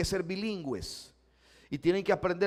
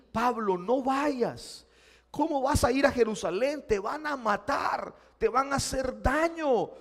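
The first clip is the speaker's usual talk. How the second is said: pleading friends; 6 semitones higher, 3 dB louder than usual. Acted. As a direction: beg him not to go.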